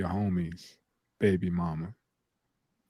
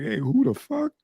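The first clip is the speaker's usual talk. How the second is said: surprised; 10 semitones higher than usual. Retold or imitated. Eyebrow-raise reaction quote.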